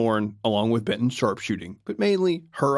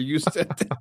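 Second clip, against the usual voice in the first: accusatory. Retold or imitated. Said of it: "this weird accusatory".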